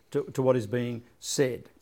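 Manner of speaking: ordinary talk, nothing marked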